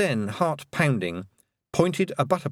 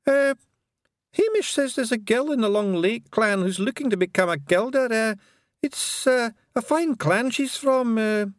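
high, hesitant Scots pixie; embarrassed Pixie; 13 semitones higher than usual. A narrator's reading. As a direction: stumble shyly as a wee Feegle. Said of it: "going violet with embarrassment".

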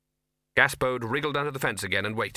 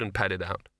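ordinary delivery, nothing marked

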